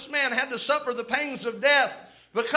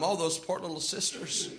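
mock pity; 7 semitones lower, 9 dB quieter than usual. Pharisees' pity imitated.

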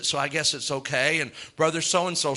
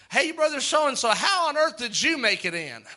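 surprised greeting; 8 semitones higher than usual. Pretend. Surprised greeting at heaven's table.

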